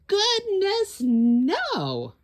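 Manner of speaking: very high, loud reassurance; emphatic no. A narrator's reading. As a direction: cheerfully dismiss the idea. Asking if Pete cried.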